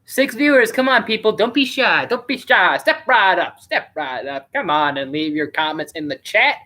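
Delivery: sing-song fairground pitch with swooping tune; lightly hammed-up fair guy drumming up a crowd; old-timey carnival barker. Streamer coaxing shy viewers into chat, as an old-timey carnival barker. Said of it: "trying to be one of those old-timey".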